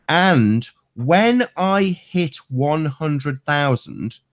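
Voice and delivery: slow, leaning hard on "when"; mock-confident boast, very slow; stress on when. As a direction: drag out "And when I hit one hundred thousand" with a heavy "when". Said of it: "get this guy".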